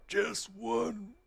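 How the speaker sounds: soft, high, strained character voice; badly faked outlaw rasp; attempted rumbly Dutch voice. Shown as a demonstration. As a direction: try for a rumble, quietly and high. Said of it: "I don't have the rumble in there. When you do that voice".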